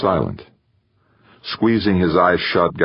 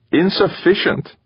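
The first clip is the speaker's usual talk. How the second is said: high-pitched, baffled echo; incredulous echo; 12 semitones higher than usual. A narrator's reading. Gaeta echoing the computer's answer, incredulous. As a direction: throw the word back much higher, baffled. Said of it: "Gaeta echoed".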